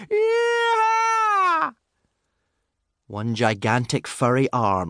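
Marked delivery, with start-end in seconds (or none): 0.1-1.7 very high, long drawn-out yell; long high "yee-haw" holler; wild whooped yee-haw. A narrator's reading. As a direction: whoop it out like a crazed driver. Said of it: "yelling as loudly in crazy excitement".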